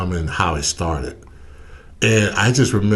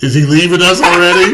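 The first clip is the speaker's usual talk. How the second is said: high, louder put-on voice of alarmed colleagues; mock worried coworkers; 9 semitones higher, 8 dB louder than usual. Office coworkers fearing he's leaving, high loud mock alarm. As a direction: play the panicking coworkers for laughs.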